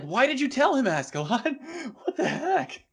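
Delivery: very high-pitched, incredulous wail; mock disbelief. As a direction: squeal it in hammed-up dismay. Replaying own shocked reaction to show.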